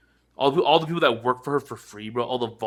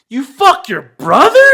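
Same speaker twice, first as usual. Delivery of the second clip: much higher, louder, slow disbelief; a hammed-up shocked shriek; shocked outburst; 11 semitones higher, 14 dB louder, slower than usual. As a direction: scream it in disbelief. Quoting shocked movie line.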